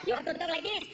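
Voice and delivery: mock Puerto Rican accent, correcting; exaggerated Puerto Rican-accented instruction. Shown as a demonstration. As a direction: show how a Puerto Rican talks. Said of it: "Puerto Rican with a Mexican accent".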